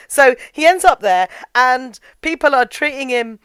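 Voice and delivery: ordinary talk, nothing marked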